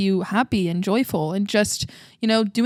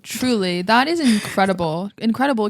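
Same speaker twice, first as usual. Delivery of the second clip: ordinary delivery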